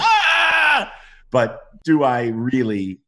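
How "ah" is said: high, loud acted scream; mock death scream. Actor acting out his pilot going down in an X-Wing, screaming.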